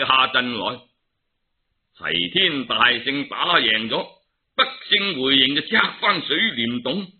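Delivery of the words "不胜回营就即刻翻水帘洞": ordinary delivery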